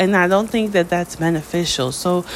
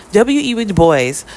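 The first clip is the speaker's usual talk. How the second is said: normal talking voice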